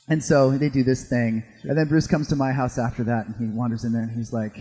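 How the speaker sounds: ordinary talk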